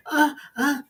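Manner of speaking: high, mock sex moan. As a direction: ham up the boss's moans. Boss voiced moaning.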